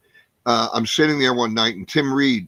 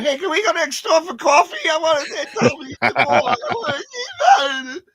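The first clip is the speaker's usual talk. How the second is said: put-on much higher, rattled kid's voice; nervous squeak; 5 dB louder than usual. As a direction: panic after the put-down, voice jumps up. And my knees buckled.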